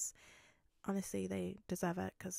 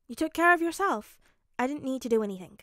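raised, louder insistence, easing into quick words; insistent protest; 4 semitones higher, 9 dB louder, faster than usual. Acted. Midoriya deflecting Bakugo's accusation, insistent. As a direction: insist you had no part in it.

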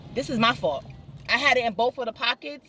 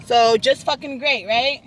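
slow, louder frustrated outburst; 4 semitones higher, 5 dB louder, slower than usual. Real, not acted. Vlogger fed up after cracking her phone, slow frustrated outburst. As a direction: spit out the curse, fed up. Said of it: "Sorry, I'm frustrated".

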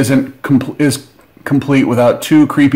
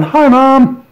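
tiny cartoon voice, much higher; a little tentacle waving hello in a squeaky chirp; tentacle saying hi; 12 semitones higher than usual. Unboxer voicing a tentacle on a miniature, squeaky and high. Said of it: "he's got a tentacle on top going".

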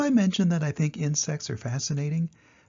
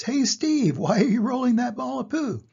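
high, lively animal voice all through; curious zebra; 10 semitones higher, 4 dB louder than usual. Acted. Zebra calls out to Steve, high and eager. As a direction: chirp the question high and eager. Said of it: "The zebra says".

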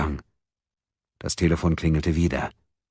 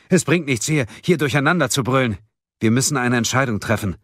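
raised, urgent, higher voice; calling out; 7 semitones higher, 4 dB louder than usual. A narrator's reading. Man urging arguing friends to decide, calling out. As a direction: call out to stop the shouting. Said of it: "rief Mickel Wold".

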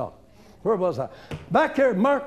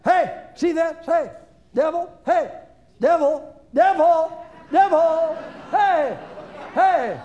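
high, loud gleeful jeering building to shouts; taunting the devil; 7 semitones higher, 3 dB louder than usual. Pretend. Preacher chasing the devil off, shouting. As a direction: chase him down, shouting.

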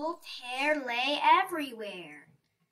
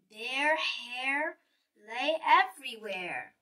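ordinary delivery, nothing marked

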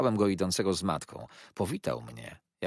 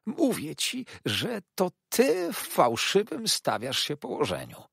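much higher, sly hiss in the ear; hissing toady; 12 semitones higher, 4 dB louder than usual. A narrator's reading. Uriah hisses at Copperfield's ear, high and sly. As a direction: hiss it close like a clinging clerk.

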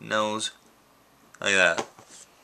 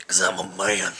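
a lightly lowered tough-guy voice; mock manly voice; 3 semitones lower than usual. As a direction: play the big man, only half serious. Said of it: "And my manly voice".

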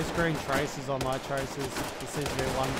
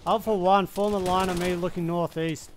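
calling out; 5 semitones higher, 6 dB louder than usual. Real, not acted. Calling squad into formation.

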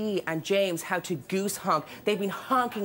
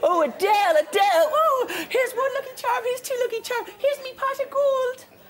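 leprechaun; 12 semitones higher than usual. Retold or imitated. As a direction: ham up a cartoon Irish leprechaun. [name] voiced, leprechaun joke.

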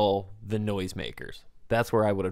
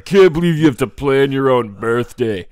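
put-on loud, raised drunken heckle; drunk Travis; 3 semitones higher, 11 dB louder than usual. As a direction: bellow it like a drunk party crasher. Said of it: "drunk Travis showing up, being like".

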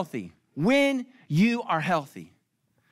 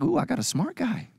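higher, delighted ooh on a smart guy; mock swoon; 5 semitones higher than usual. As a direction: gush over finding a smart guy. You're like.

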